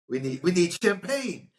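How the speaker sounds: high, loud squeal of a demand; imitating Chris Tucker. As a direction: yell it like a diva wanting champagne. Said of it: "quoting Chris Tucker's line".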